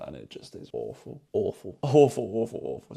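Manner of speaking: laughing, over-the-top disgust. Real, not acted. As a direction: groan it out, half laughing. Mocking bad openers.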